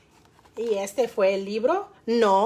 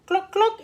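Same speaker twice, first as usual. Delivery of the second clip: high, short clucks; hen clucking; 6 semitones higher than usual. A narrator's reading. Storyteller imitates the hens, clucking high. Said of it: "hicieron las gallinas".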